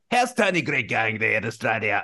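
jokey South African accent demo; playful put-on South African accent. Shown as a demonstration. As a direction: do a South African cricket-fan voice. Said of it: "South African. That's right".

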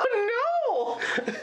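high burst of laughter into oh no; startled, laughing cry; surprised laugh. Real, not acted.

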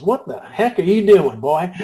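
loud mock Texan scolding a new hire in a Texas accent. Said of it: "as only Tom can do with his Texas accent".

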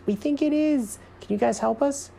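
very high little squirrel voice; hopeful squirrel. A narrator's reading. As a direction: plead lightly in a tiny high voice. Squirrel asks for help.

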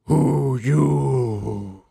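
lightly voiced gruff orc; a hint of a dim orc grunting at the door; lightly voiced orc, blunt. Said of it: "the orc is just kinda like".

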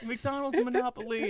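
very high, strained comic proclamation; mock grandiose boast. Crook boasting from his mansion balcony, mock grandiosity. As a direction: proclaim it in a squeaky put-on holler.

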